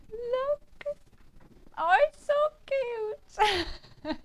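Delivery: high, slow gushing coo; squealing at something adorable. Real, not acted. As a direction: melt over how cute it is. Gushing over cute pigs.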